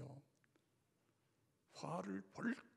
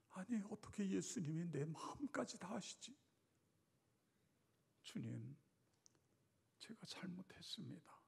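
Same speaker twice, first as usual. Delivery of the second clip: hushed confession; 2 semitones higher, 6 dB quieter than usual. Acted. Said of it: "여러분이 가론 유다였다면".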